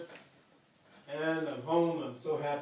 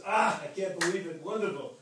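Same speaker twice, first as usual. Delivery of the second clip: higher, louder mock delight; retold happy greeting; 3 semitones higher, 4 dB louder than usual. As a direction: imitate people thrilled at your arrival. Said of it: "they were so happy to see us".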